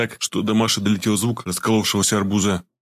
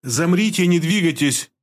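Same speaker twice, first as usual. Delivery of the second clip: all-out command; 8 semitones higher, slower than usual. A narrator's reading. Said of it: "повторила девушка, выкладываясь до предела".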